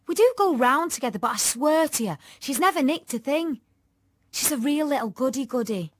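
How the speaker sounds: put-on high, forceful girl's voice; loud, earnest appeal to a police officer; insistent pleading. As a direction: argue firmly to clear your friend. Tanya argues for her friend.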